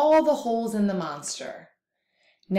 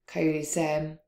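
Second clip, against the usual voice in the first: plain reading voice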